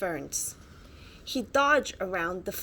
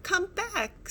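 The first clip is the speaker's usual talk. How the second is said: high, plaintive call; crying out; 10 semitones higher than usual. Acted. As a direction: call out after it. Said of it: "Sid cried".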